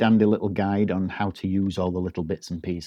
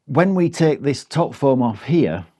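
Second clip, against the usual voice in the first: ordinary talk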